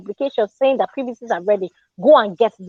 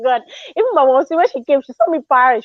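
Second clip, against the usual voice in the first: ordinary delivery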